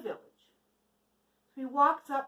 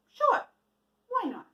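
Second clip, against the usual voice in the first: high, bright-pitched easy yes; lightly voiced gentleman, happily agreeing; cheerful agreement; 10 semitones higher, 5 dB louder than usual. Gentleman with the pot cheerfully lending it, higher voice.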